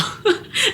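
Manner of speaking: a short high burst of laughter. Real, not acted. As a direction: laugh at your own story. Laughing at her own story.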